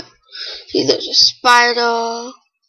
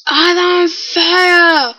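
drawn-out, raised panicked yell; slow alarmed cry; 5 semitones higher, 3 dB louder, much slower than usual. Real, not acted. Kid cries out as his game character burns, slow alarm. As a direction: stretch it out in alarm, louder.

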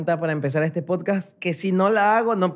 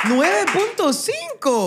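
high, slow, stretched-out celebration; triumphant proclamation; 9 semitones higher, much slower than usual. Real, not acted. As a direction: proclaim the score like a big win. Proclama la nota de su amiga. (proclamación triunfal, aguda y alargada)

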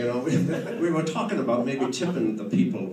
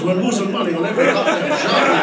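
mock rant; 5 semitones higher, 9 dB louder than usual. Real, not acted. Ranting about losing money.

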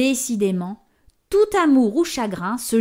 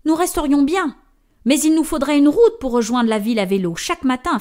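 fast, higher, louder talk; quick remark; 5 semitones higher, 5 dB louder, faster than usual. Acted. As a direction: rush the practical point. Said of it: "fait remarquer la mère". (remarque rapide)

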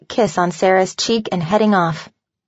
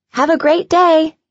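put-on child's high call from the bus; yelled goodbye; 9 semitones higher, 4 dB louder than usual. A narrator's reading. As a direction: call it out high, a little louder. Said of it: "she yelled".

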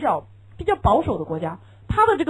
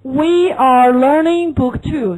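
slow model answer; 6 dB louder, much slower than usual. Shown as a demonstration. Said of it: "你会怎么说".

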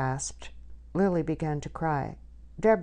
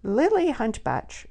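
louder, slow, sharply calling her name; stern summons with some bite; sharp asperity; 8 semitones higher, 5 dB louder, slower than usual. A narrator's reading. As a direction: pull her up short by name. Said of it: "spoke with asperity".

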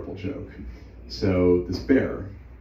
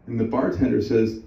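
ordinary delivery

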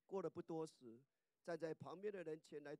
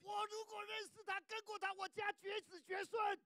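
high, loud, slowing curse; shouted curse; 7 dB louder than usual. Retold or imitated. Preacher shouts Peter cursing his own family, high and loud. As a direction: hurl the curse.

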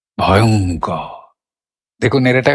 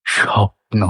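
dreamy feeling; 3 semitones lower, much slower than usual. Shown as a demonstration. Teacher voicing the feeling of a dream to students. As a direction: breathe স্বপ্ন like a dream. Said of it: "স্বপ্নের অনুভবটা প্রকাশ করতে পারলাম".

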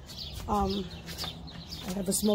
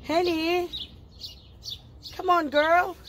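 calling a pet; 7 semitones higher, slower than usual. Real, not acted. Owner calling Henny over, high coaxing call. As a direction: coax the dog to come, up high.

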